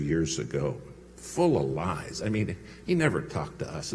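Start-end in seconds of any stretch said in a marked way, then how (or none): none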